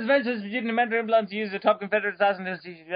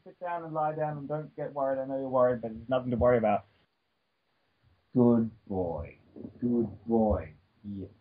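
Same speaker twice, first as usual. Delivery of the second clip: low, soft, calming words to an anxious pet; soothing the pet; 8 semitones lower, 4 dB quieter than usual. Real, not acted. Talking to her worried pet, low and soothing. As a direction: settle him down gently.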